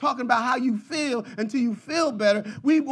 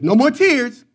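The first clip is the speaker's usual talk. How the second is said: mock cry out; 5 semitones higher, 11 dB louder than usual. Recalling crying so hard.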